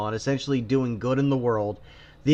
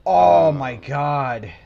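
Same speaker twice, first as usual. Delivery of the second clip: exasperated groan; 7 dB louder, much slower than usual. Real, not acted. Reacting to painful review.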